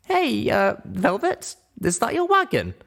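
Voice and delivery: put-on high, eager young pony voice; small mare walking friends through a rescue; young Littlepip explaining. Littlepip asks about the wagon.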